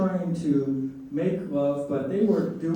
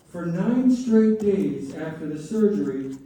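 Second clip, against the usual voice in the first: ordinary talk, nothing marked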